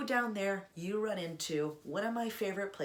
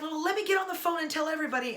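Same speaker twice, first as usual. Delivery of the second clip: eager; 9 semitones higher, 5 dB louder, much faster than usual. Retold or imitated. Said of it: "And then I was like".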